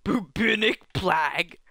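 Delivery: slow, drawn-out mock British accent; hammy British accent, stretched slowly. Joking about burping in British, slow mock British accent. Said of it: "Burps in British".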